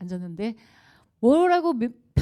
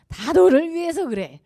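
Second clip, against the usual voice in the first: loud, higher, hammed-up parent lecture; mock nagging parent; 6 semitones higher, 10 dB louder than usual. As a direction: play the parent insisting it's all for your sake.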